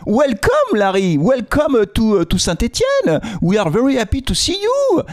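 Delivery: high-pitched, drawn-out English welcome to a new boss; playful gushing welcome; mock welcome. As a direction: greet him with hammed-up delight in French-accented English. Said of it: "on se dit, «Hey, Larry".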